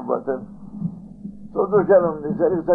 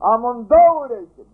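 slow, loud, higher ending of a threat; God's warning rising loud and slow; stern warning; 9 semitones higher, 7 dB louder, slower than usual. Retold or imitated. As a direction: drive the threat home.

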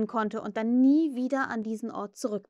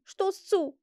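high determined command; firm, raised-pitch order; resolute; 8 semitones higher than usual. A narrator's reading. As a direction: urge it resolutely. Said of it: "sagte er entschlossen".

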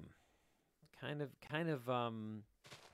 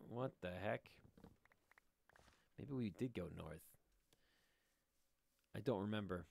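ordinary talk, nothing marked

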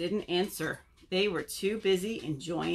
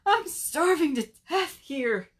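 high, plaintive mock whimper; 8 semitones higher, 3 dB louder than usual. Starving Bird pities himself, mock whimpering. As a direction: whine it up high. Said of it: "whimpered Bird".

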